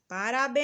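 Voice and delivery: Parabéns with a long, loud, held BÉNS; calling out, drawn-out. Shown as a demonstration. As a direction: call out Parabéns, stretching the last syllable béns. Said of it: "Onde demoramos mais?".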